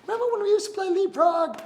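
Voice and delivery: very high, loud squeaky king; king calling eagerly to his old friend rook. Acted. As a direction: squeal it out like an excited little king. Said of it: "saw him and said".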